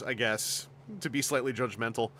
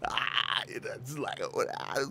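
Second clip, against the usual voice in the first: mocking speed-dating dude; 2 semitones lower, 5 dB quieter than usual. Streamer mocks a speed-dating show contestant's voice. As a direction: do the dating-show guy's lazy mumble. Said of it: "they're like; that voice".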